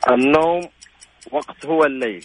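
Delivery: the sentence said backwards, word by word; backwards speech. Shown as a demonstration. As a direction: say it from the last word to the first. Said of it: "تبتدي من آخرها لأولها". (الجملة بالمقلوب)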